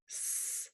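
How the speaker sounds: a long, hissed s; held s sound. Shown as a demonstration. Therapist demonstrating long fricative sounds. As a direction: hold the s out. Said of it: "these long sounds like".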